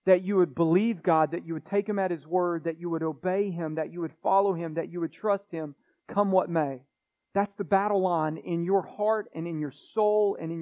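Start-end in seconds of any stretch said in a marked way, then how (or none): none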